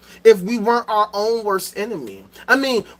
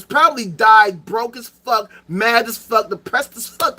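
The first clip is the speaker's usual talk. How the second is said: normal talking voice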